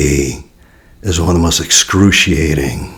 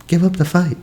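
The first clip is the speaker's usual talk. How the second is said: much higher, soft echo of the phrase; high repeat; 12 semitones higher, 4 dB quieter than usual. Shown as a demonstration. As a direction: say it again way up high and gentle. Repeated in a different voice.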